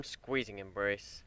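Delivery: plain reading voice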